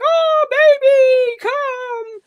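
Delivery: put-on high, slow, weepy baby talk; mock cooing mummy. Dad imitating a fussing mum comforting a hurt toddler, high and weepy. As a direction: coo over the child like a fussing mum. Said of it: "mummies might be like".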